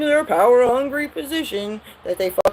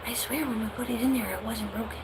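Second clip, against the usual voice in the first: fast, much softer aside between parents; hushed sidebar; 2 semitones higher, 11 dB quieter, faster than usual. Retold or imitated. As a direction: murmur it so the kid can't hear. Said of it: "their little sidebar conversation".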